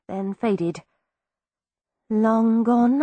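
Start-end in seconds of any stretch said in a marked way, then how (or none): 2.1-3.0 muttered. A narrator's reading. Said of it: "I muttered".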